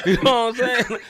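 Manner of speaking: words squeezed out through laughter, high and loud; can't stop laughing; real laughter. Real, not acted. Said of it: "laughing through the line".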